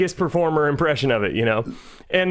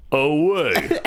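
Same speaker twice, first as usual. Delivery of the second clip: action-star impression; Stallone. Finishing the misquoted catchphrase, action-star impression. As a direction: tough movie-star correction. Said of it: "your Stallone".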